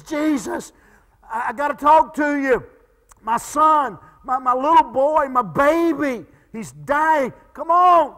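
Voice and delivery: a frantic father pleading for his dying son; desperate father. Acted. Desperate father begs Jesus.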